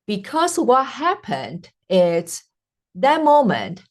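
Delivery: ordinary delivery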